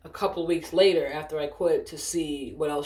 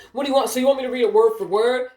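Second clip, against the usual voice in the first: louder, higher, argumentative retort; exasperated challenge; 6 semitones higher, 6 dB louder than usual. Retold or imitated. Agent asking supervisor about reading word for word, exasperated. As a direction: throw the question back in exasperation.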